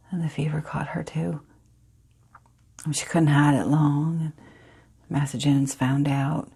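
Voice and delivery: put-on hushed Southern girl recalling the fever; soft, halting memory with long pauses; Phoebe. Phoebe recalls her mama and the fever, hushed, sorrowful storytelling. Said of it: "The character is Phoebe".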